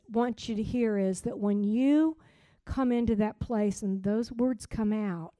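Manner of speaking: ordinary talk, nothing marked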